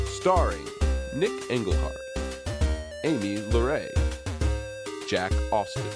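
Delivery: ordinary talk, nothing marked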